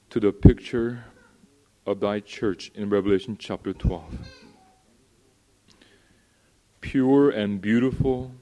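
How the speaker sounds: loud, pleading pulpit prayer; fervent prayer. Real, not acted. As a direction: pray out loud over the congregation. Leads a loud public prayer.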